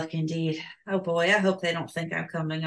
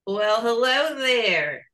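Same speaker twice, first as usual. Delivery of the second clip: slow, drawn-out, higher-pitched hello; flirty greeting; 4 semitones higher, 5 dB louder, much slower than usual. Acted. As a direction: draw it out like a come-on. Flirty greeting to two men.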